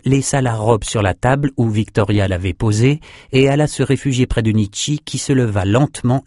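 usual speaking voice

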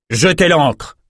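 high, firm command; king commanding his men; order. A narrator's reading. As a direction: bark the order to the crew. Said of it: "ordonna Calvert".

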